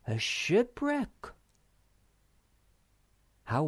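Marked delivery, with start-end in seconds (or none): 0.0-1.3 high, drawn-out astonishment; slow, high cry of discovery; amazed exclamation. A narrator's reading. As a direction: stretch it out with the pitch soaring. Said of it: "exclaimed the Snork".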